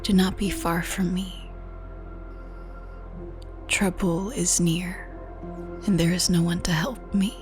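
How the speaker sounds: ordinary delivery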